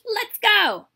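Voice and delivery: high, loud battle call; rally the brothers to charge; rallying shout. A narrator's reading. Boy rallies brothers to charge.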